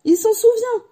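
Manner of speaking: very high, loud exclamation of joy; delighted cry. A narrator's reading. Diggle learning Harry remembers him, thrilled cry. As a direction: cry it out to the whole room, thrilled. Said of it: "s'écria Diggle".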